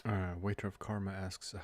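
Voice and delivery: usual speaking voice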